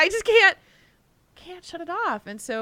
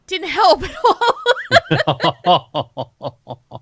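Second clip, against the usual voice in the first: laughs out the last word, higher and louder; real laughter breaking through; laughing in disbelief; 9 semitones higher, 10 dB louder, much slower than usual. Real, not acted. Laughing at own failure.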